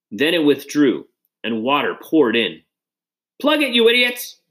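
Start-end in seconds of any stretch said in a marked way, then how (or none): 3.4-4.4 high, frantic yelled order; high-pitched, exasperated yell; angry yell. A narrator's reading. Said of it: "Pratt yelled".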